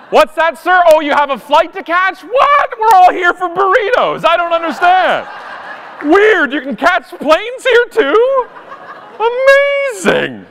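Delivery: high, loud, fake-astonished; sarcastic mock surprise. Mocking a late flyer's excuse with sarcastic surprise. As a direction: ham up the disbelief, stretching the end slowly. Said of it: "passive-aggressive politeness".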